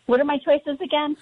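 ordinary talk, nothing marked

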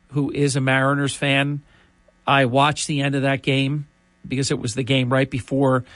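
usual speaking voice

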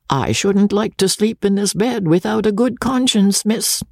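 put-on high, solemn old servant woman; a gloomy old charwoman warning; chatty grumbling charwoman. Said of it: "said the old woman".

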